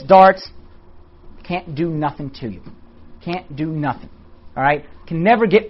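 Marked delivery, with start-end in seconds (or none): 1.4-4.1 hushed. Acted. Devil's darts powerless, hushed.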